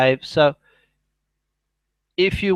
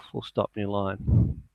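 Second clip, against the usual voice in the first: softer aside dictating punctuation; 5 semitones lower, 7 dB quieter, slower than usual. Real, not acted. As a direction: drop the voice for the punctuation commands.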